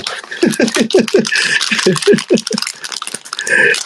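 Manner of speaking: long, high cackling laugh; drawn-out high giggling fit; real laughter. Real, not acted. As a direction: burst into a helpless, high-pitched laugh. Laughing at his own remark.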